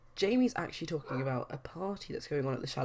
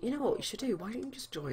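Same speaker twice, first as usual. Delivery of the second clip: higher-pitched pally urging; eager suggestion; 9 semitones higher than usual. Acted. Corey urging his bro to join in, eager suggestion. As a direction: pitch it up, coaxing a mate.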